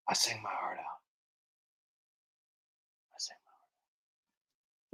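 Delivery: fading into a bare whisper; whispered repeat. Shown as a demonstration. Creator echoing his boast about the song, whispered.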